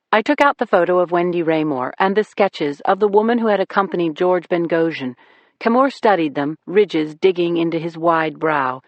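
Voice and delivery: usual speaking voice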